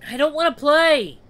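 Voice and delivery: put-on high, loud little boy's cry; protesting child. Boy stuck behind the fence, high loud protest. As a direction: cry out as a frightened, unwilling child. Jeered boy refuses the game.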